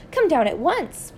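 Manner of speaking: high, drawn-out invitation; eager cry. A narrator's reading. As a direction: call the neighbor down, slow and high. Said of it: "Missus Silver cried".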